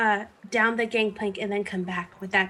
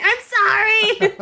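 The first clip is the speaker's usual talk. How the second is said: high, slow, drawn-out sorry; still apologising; 13 semitones higher, 6 dB louder, slower than usual. Acted. Muddle apologising to the dwarf, high and slow. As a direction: stretch the apology out sweetly. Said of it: "I'm still saying".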